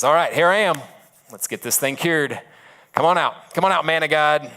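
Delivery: acted impatient holler to come out; calling out. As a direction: summon someone out of the house. Naaman calls at prophet's front door.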